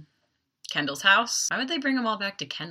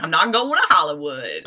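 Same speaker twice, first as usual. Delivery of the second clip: mock-proud, louder boast; proud announcement; 7 dB louder than usual. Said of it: "he comes out proud".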